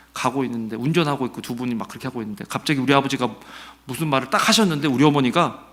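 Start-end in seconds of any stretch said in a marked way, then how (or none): none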